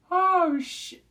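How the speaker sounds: loud, high oh shit; alarmed outburst. Real, not acted. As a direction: blurt it out in shock. Telling of the stampede.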